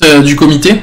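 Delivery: son saturé, micro qui crache; voix distordue par la saturation. Real, not acted. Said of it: "ça sature". (micro saturé, son distordu)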